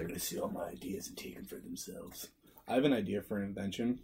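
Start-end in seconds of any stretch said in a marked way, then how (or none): 0.0-2.3 hushed whisper imitating scheming viewers; soft, conspiratorial whispered quote; mock whisper. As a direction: whisper it like a secret plot.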